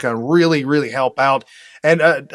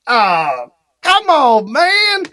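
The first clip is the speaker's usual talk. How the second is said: exasperated; 6 semitones higher, much slower than usual. Real, not acted. Exasperated scoff at critics.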